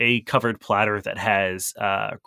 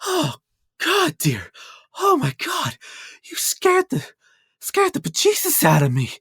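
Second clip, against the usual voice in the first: high, breathless fright, voice jumping way up; a frightened little cook catching her breath; halfling cook gasping after a scare; startled halfling cook. Acted. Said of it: "is breathing heavily".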